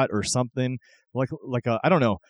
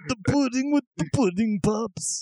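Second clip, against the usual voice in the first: a much higher, stretched commercial pitch; Cosby pudding voice; 10 semitones higher than usual. Retold or imitated. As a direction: sell the pudding like a silly spokesman. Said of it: "Jell-O commercials in that voice".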